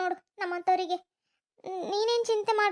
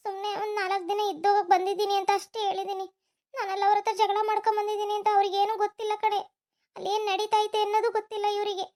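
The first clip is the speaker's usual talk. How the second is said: usual speaking voice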